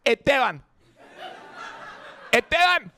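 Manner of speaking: Esteban high and quick, first s dropped; Puerto Rican pronunciation. Retold or imitated. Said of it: "And I say my name".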